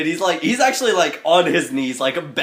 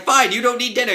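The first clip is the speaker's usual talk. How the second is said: high, loud retort; mock arguing; 9 semitones higher, 5 dB louder, faster than usual. Game master voices a quarrel over dinner, loud high retort. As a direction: throw it back loudly in a play quarrel. Acting out an argument over food.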